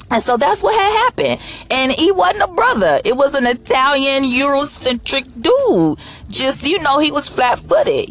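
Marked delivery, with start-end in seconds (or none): none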